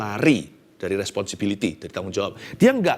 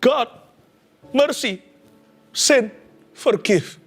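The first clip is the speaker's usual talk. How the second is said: God, mercy, sin, forgive as separate words with long pauses, higher; broken halting words; 11 semitones higher, much slower than usual. Shown as a demonstration. Preacher showing the broken prayer, halting. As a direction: stammer each word out on its own. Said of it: "Jadi bunyinya seharusnya begini".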